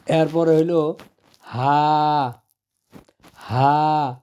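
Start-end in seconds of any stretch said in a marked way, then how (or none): 1.4-4.1 হা like Bangla হ; plain breathy হা; Arabic ha. Shown as a demonstration. Teacher demonstrating ha to students, light. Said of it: "Bangla হয়ের মতো উচ্চারণ হবে".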